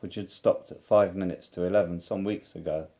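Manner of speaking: plain reading voice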